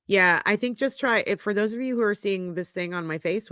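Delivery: ordinary talk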